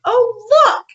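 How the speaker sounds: high, delighted "oh, look"; thrilled at finding the mushrooms; delighted gasp. Retold or imitated. Delighted at the mushroom ring, high excited exclaim. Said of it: "I was like".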